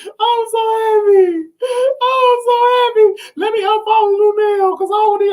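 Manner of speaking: high, loud cries of happiness; joyful shouting. Real, not acted. Streamer telling viewers she's so happy, loud joyful cries. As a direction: celebrate at the top of your voice.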